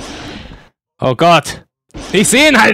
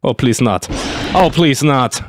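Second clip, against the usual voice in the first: ordinary delivery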